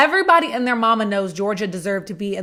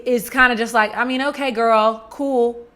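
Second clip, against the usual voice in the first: ordinary talk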